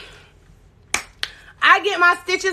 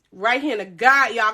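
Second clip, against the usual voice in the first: ordinary delivery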